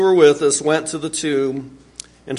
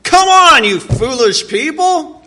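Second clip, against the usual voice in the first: sharp, much higher and louder exasperated rebuke; angry rebuke; 11 semitones higher, 6 dB louder than usual. Pretend. Jesus rebuking his disciples, sharply frustrated. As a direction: scold them in sudden frustration. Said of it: "Or was he angry?".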